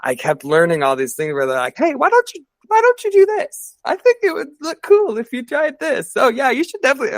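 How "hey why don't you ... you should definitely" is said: high, louder, pushy producer impression; mock producer voice. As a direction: imitate a producer selling an idea, sugary and high. Mimicking producers pitching ideas.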